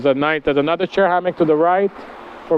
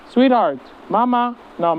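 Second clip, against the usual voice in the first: high pet call; 7 semitones higher than usual. Real, not acted. Calling the cat back.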